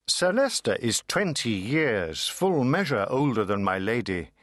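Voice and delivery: ordinary delivery, nothing marked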